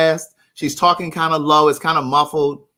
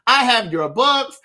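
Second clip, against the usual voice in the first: slow, higher, laughing imitation; 7 semitones higher, slower than usual. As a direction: tease with a laugh. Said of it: "she gonna be like".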